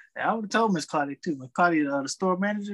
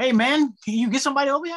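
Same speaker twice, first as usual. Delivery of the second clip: higher, louder demanding customer call; pushy customer; 8 semitones higher, 5 dB louder than usual. Retold or imitated. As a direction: bark it out like an impatient customer. Angry customer calling him over.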